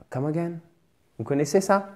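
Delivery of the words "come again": puzzled listener. Acted. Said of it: "la personne va vous regarder et va faire".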